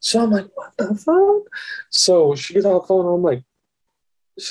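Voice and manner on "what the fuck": very high, incredulous mock squeak; squeaky comic bewilderment; shocked disbelief. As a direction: shoot the pitch way up in retold shock. Retelling his shocked reaction.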